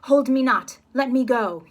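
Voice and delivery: loud, high struggling cry; loud high struggle to break free; straining shout. Acted. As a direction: shout to be let go. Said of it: "turns to his wife".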